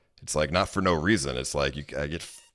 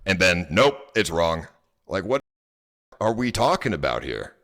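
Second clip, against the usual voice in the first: aggravated; 5 semitones higher than usual. Real, not acted. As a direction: lay out the facts in disbelief. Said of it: "it's aggravating".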